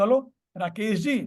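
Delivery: "Hello Rakesh जी" with a rise on जी, as a question. Shown as a demonstration. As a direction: lift the end of "Rakesh जी" to check who it is. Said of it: "questioning accent में".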